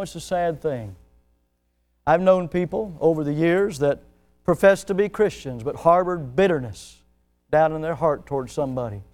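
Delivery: normal talking voice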